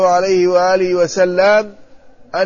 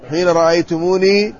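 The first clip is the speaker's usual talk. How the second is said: ordinary talk, nothing marked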